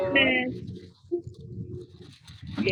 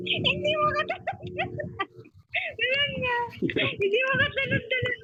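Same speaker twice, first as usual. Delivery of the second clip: teasing sing-song; 3 dB quieter than usual. Pretend. Teasing mimic of the other player.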